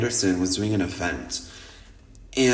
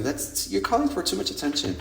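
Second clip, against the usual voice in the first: higher prissy imitation of disapproving friends; mocking prim friends; 8 semitones higher, 4 dB quieter than usual. Conservative friends scolding her, in a mock prim voice.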